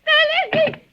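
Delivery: high, loud panicked wail; terrified cry. Acted. Terrified woman realising someone listens, crying out. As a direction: break on the word in terror.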